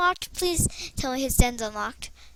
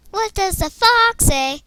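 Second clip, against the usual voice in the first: higher, louder, slow song-title call; louder playful announcement; excited; 7 semitones higher, 9 dB louder, slower than usual. Real, not acted.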